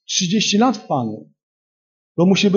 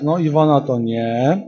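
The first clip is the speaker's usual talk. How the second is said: slow mock rejection; 2 semitones lower, slower than usual. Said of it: "Duch Święty przychodzi i mówi tak".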